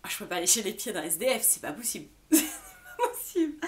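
protesting through laughter; laughing. Real, not acted. Refusing to lick a homeless man's feet, laughing. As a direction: laugh while refusing the idea.

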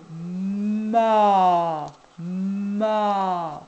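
"ma" stretched long and loud, M held before the vowel; insisted syllable sound. Shown as a demonstration. Teacher dictating a syllable to a child, "ma" insistently drawn out. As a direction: draw "ma" out with a long hummed M, swelling into the A. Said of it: "n'hésitez pas à insister".

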